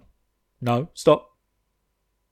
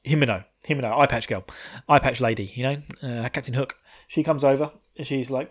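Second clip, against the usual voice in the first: ordinary talk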